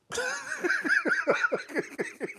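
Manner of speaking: squealing burst of laughter; high shrieking laugh. Real, not acted. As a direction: let out a high-pitched cackle. Laughing at own joke.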